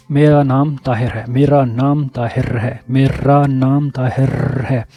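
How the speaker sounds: careful slowed repetition; slow practice drill. Retold or imitated. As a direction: drill the sentence slowly, rolling the r. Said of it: "اس sentence کو ... repeat کیا".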